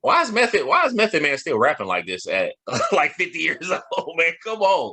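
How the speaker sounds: high, disbelieving rant; why is he still doing this; incredulous. Real, not acted. Incredulous at [name] still rapping.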